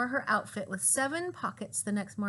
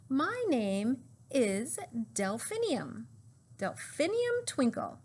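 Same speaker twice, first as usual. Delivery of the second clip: slow, drawn-out, rising to a high Twinkle; proud slow reveal; 4 semitones higher, slower than usual. Acted. Teacher revealing her flower name to the class, slow and proud. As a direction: savour each syllable of the name.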